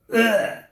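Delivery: loud mock retch, then a gasp; acted-out heave followed by horror; imitated retching and horrified gasp. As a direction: imitate gagging into a sick bag. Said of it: "It made this sound, kinda like".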